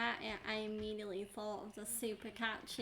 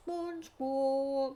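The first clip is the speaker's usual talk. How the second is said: cartoon voice; 4 semitones higher, 9 dB louder, much slower than usual. Voicing a character.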